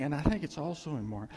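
ordinary talk

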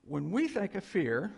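ordinary talk

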